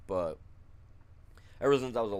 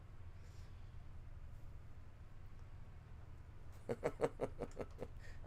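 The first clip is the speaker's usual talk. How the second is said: long airy unvoiced laugh; breathy laugh; 5 semitones higher, 13 dB quieter than usual. Real, not acted. Laughing at the wheelchair comeback he imagined, airy laugh. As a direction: laugh at your own cheeky joke.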